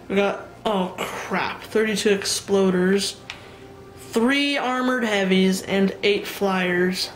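slowed, dreading count. Real, not acted. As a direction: drag out each number in dread. Dreading huge enemy wave.

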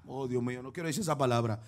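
hushed embarrassed aside; quiet aside. Real, not acted. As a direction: mutter it under your breath to yourself. Preacher hesitates over a word.

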